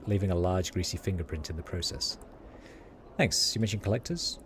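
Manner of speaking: ordinary delivery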